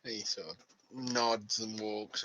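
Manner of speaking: ordinary talk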